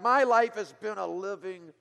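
loud, slow bitter outburst on "My life has been a living,"; a young man's loud, bitter complaint about his life; spat out bitterly. Retold or imitated. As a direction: spit the words out in anger. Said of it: "he spat those words out again".

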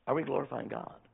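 ordinary talk

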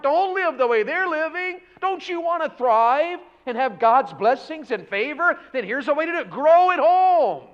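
high, loud pulpit climb; fiery preaching. Acted. Pastor urging the church to thrive, fiery. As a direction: drive the point to its peak.

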